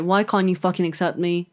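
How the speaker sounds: usual speaking voice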